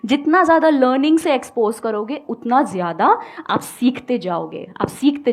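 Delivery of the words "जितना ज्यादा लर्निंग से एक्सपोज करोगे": ordinary talk, nothing marked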